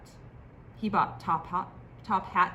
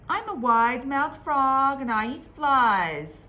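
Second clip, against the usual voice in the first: loud frog boast; 7 semitones higher, 8 dB louder than usual. Acted. Frog repeats his line louder.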